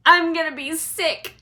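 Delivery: nauseous woman yelling; yelling queasily. A narrator's reading. Missus TV on the swerving ride, queasy yell. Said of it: "yelled Missus T V".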